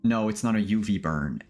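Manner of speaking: ordinary talk, nothing marked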